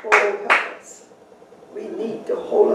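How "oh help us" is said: ordinary delivery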